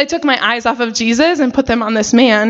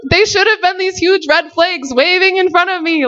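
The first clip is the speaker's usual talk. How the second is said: higher, voice close to crying; near tears; 7 semitones higher than usual. Real, not acted. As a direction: let the tears come into it. Emotional recalling ignored red flags.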